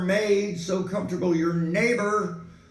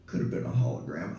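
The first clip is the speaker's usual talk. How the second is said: low quick aside; 12 semitones lower, 6 dB quieter, faster than usual. Acted. Mock robotic aside.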